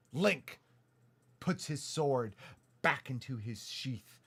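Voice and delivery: usual speaking voice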